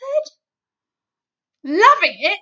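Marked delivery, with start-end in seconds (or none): 1.7-2.4 loud incredulous yell. A narrator's reading. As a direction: yell it back in outrage. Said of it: "yelled the blood bottler".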